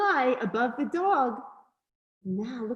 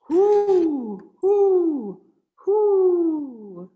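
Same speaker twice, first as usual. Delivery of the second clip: loud, high, falling hoots; owl hooting; 6 semitones higher, 9 dB louder, much slower than usual. A narrator's reading. Reader voicing the owl for a child, loud hoots. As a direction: hoot long and loud like an owl. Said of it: "asked the owl".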